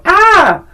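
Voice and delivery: short high yelp; a sudden high startled "Ah!"; mock scream. Host imitating the scream the character never gives, high yelp. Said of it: "even go".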